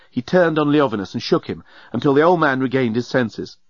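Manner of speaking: plain reading voice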